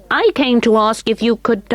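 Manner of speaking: put-on a small boy's polite voice. A narrator's reading. William voiced.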